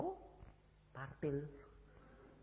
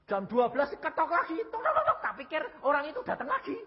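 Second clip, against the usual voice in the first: squealed, excited retelling of the knocks; high-pitched alarm; 12 semitones higher, 4 dB louder than usual. Retold or imitated. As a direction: shoot up high, as if startled by knocking. Retells renewed knocking at midnight. (kaget dengan suara melengking tinggi)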